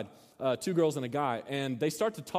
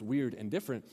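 usual speaking voice

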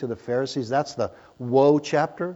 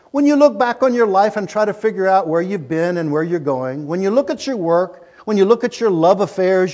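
louder, higher reading of her words; quoted Shirley MacLaine; 5 semitones higher, 6 dB louder than usual. Retold or imitated. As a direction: read the quote with rising fervour.

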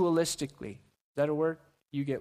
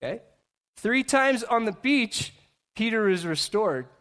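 ordinary delivery

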